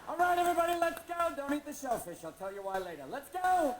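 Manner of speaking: loud, high call to the crowd; rallying shout. Acted. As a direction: herd everyone out, yelling.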